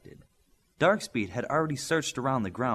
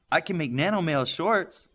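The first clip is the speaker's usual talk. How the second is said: eager, higher blurt; 6 semitones higher, 4 dB louder than usual. Acted. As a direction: jump in with the idea before anyone else. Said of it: "Midas blurted".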